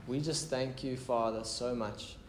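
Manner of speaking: quiet, intimate prayer leading. Real, not acted. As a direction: pray aloud gently and earnestly, voice dropping at phrase ends. Leads the congregation in prayer.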